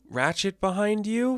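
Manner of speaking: high-pitched imitation of a questioning character; high, slow acted question; anxious question. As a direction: ask it high and drawn out. Wheeljack's asking, acted question.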